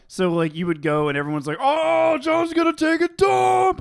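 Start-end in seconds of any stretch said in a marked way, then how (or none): 1.6-3.8 high, loud mock kid jeer; drawn-out taunt, pitched way up; teasing classmates. Mimicking classmates teasing loudly.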